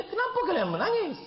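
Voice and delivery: high, loud, wondering question; high, loud, puzzled widower's question; voiced widower. Widower asks crying friends.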